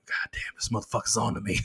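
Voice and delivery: hushed, breathy whisper through laughter; whispered aside. Retold or imitated. Comic whispering his inner panic to the audience. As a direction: whisper it like a guilty secret. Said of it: "And I'm like".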